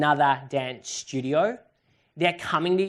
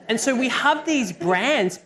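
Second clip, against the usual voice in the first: ordinary talk, nothing marked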